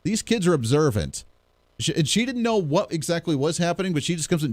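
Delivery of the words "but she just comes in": ordinary talk, nothing marked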